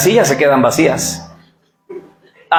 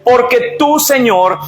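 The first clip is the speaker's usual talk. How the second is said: raised high and ringing, proclaiming; preaching at full force; 9 semitones higher than usual. Acted. Preacher reads the psalm aloud to the congregation, proclaiming. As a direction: proclaim it to the whole church.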